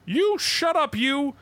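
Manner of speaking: high-pitched mock outburst; mock losing it. Character losing it on his other self, mock angry. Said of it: "he just loses it on him".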